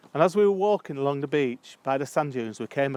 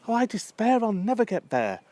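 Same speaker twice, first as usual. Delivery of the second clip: higher-pitched theatrical lament; mock despair; 7 semitones higher than usual. Lost traveller to the person giving directions, mock despairing. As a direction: despair lightly, voice lifted.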